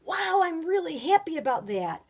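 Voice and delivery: high, loud happy exclamation; excited. Shown as a demonstration. Teacher showing an exclamation, high loud excitement. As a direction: burst out with delight. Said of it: "Any of those are strong feelings".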